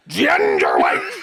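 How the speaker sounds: slow, drawn-out indignant shout in a put-on Irish accent; affronted Irish foreman shouting ginger wigs; outraged punchline. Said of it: "this is the moment where it kicked in".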